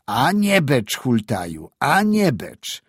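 loud, high emphatic scolding; stern warning. A narrator's reading. Teacher warns the boy while thrashing him, scolding. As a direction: drive each warning home, drawing out the last. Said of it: "usłyszał przestrogę".

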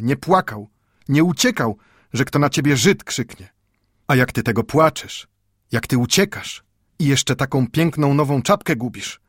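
put-on angry old grandfather. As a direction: scold the boy out of hurt love. Scolding rises to outrage.

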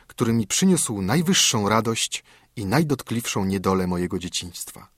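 ordinary delivery, nothing marked

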